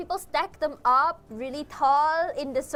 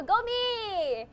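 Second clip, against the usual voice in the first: sarcastic cheer; 7 semitones higher than usual. Real, not acted. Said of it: "I'll just probably get a heart attack later".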